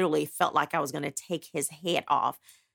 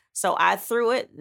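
ordinary talk

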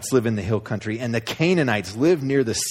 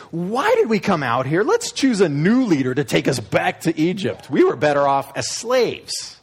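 whiny grumbling; 5 semitones higher, 4 dB louder than usual. Acted. Grumbling Israelites quoted.

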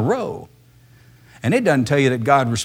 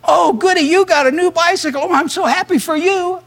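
much higher, louder, sugary fake delight of a kid; sarcastic childish "oh goody" voice, pitched way up; mock happy child; 4 dB louder than usual. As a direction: play a sibling pretending to be thrilled, hammed-up. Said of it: "they're not gonna be jumping up and down saying".

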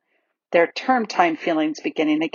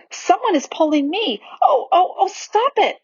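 breathless fright; 12 semitones higher than usual. Acted. Susan pulled by unseen magic.

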